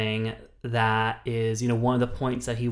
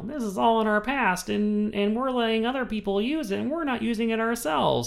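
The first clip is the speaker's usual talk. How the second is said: much higher put-on orator voice; mock rally speech; 11 semitones higher than usual. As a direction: rouse the crowd like a campaigner. Moore rallying cry parody.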